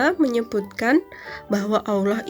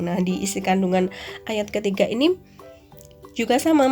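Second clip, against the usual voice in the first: ordinary talk, nothing marked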